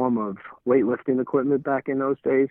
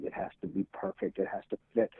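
hushed; 8 semitones higher, 10 dB quieter than usual. Retold or imitated. Explaining how naming shoes works.

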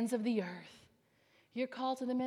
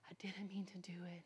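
whispered confession; 3 semitones lower, 13 dB quieter than usual. Retold or imitated.

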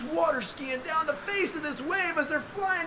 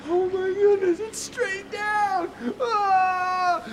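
high, loud frightened wail, slow on straight down; panicked cry; 8 semitones higher, 4 dB louder, much slower than usual. Acted. Apostle looking down the wave, crying out in fear. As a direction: cry out in terror at the drop.

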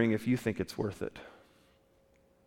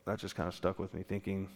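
much softer, lower, to himself; quiet repeat; 4 semitones lower, 10 dB quieter than usual. Real, not acted. Preacher musing to his congregation, softly. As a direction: let it sink in quietly.

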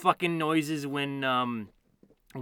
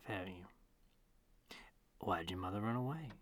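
ordinary talk

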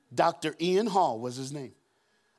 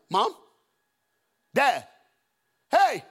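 put-on worried kid calling for parents; 7 semitones higher, 6 dB louder, slower than usual. Kid home from school finds front door open, calling out. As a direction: call out, louder and higher each time.

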